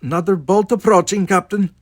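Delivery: high, loud, agitated warning; great agitation. A narrator's reading. Chekhov warning captain of incoming fire, greatly agitated. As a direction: raise the alarm in a fluster. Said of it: "Chekhov said with great agitation".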